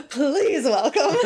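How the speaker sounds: slow, high, laughing imitation of a grudging introduction; mock-reluctant herald; reluctant announcer. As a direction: announce it as if forced, half laughing. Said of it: "looks like he does not wanna be there".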